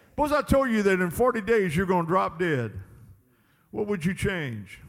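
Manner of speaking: usual speaking voice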